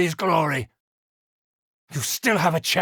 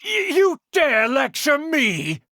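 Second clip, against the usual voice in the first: outraged shout; 7 semitones higher than usual. Acted. Uncle shouts at niece for lecturing him, outraged. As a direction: shout it in disbelief.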